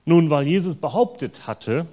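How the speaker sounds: usual speaking voice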